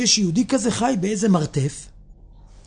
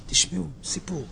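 near-whispered, low invitation; hushed; 4 semitones lower, 8 dB quieter than usual. Real, not acted. Speaker inviting listeners to hear a story, hushed whisper. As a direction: drop almost to a whisper.

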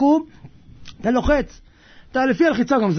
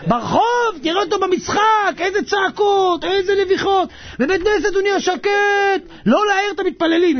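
tag; 9 semitones higher than usual. Retold or imitated. Mocking shouts at game versus silence.

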